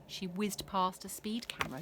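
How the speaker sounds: ordinary delivery, nothing marked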